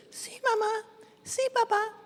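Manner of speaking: dutiful tiny voices replying; high, quiet "yes mama, yes papa"; little mice answering. Acted. Children answering parents.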